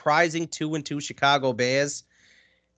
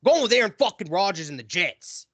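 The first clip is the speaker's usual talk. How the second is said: New York accent; 4 semitones higher than usual. Shown as a demonstration. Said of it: "go a little bit New York over here".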